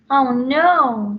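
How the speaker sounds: loud, high dismay; dismayed Bella. Acted. Bella seeing the hairy creature. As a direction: wail it, horrified. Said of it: "said Bella".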